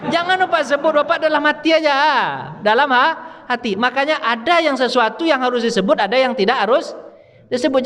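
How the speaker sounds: high-pitched, emphatic sermon delivery; forceful preaching. Acted. Preacher teaching the congregation about takziah, forceful preaching. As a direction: drive each point up high for listeners to finish.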